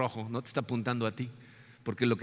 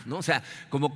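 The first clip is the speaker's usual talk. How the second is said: ordinary talk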